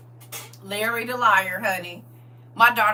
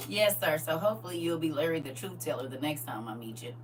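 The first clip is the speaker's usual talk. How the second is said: ordinary delivery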